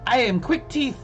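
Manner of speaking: high, excited little kobold voice; pixie. Acted. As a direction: chirp it out like an eager tiny shopkeeper. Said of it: "he looks at you".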